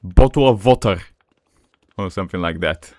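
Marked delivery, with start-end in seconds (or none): 0.0-1.0 thick, emphatic Slavic accent; Slavic-accented English, punched out. Shown as a demonstration. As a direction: say it like a Slavic speaker, firmly. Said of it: "So basically a Slavic accent".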